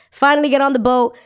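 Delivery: ordinary talk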